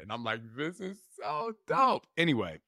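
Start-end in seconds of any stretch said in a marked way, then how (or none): 0.6-2.1 high, drawn-out retold amazement; amazed delight. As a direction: react thrilled, pitched way up and slowed. Amazed reply to coach's confession.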